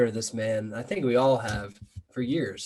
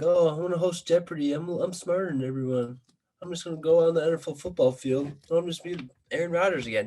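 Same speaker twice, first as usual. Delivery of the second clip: higher-pitched mocking imitation; mock surly; 6 semitones higher than usual. Angry fan mimicking his quarterback, mock surly. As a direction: imitate a smug, surly know-it-all. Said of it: "Being all surly, being all like".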